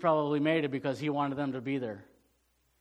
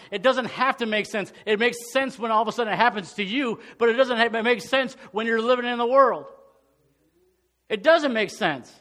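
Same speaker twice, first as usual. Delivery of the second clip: louder, higher preaching, pressing every phrase; full-force preaching; 7 semitones higher, 8 dB louder than usual. Acted. Pastor preaching to his church, full force and raised. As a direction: drive the point home to the congregation.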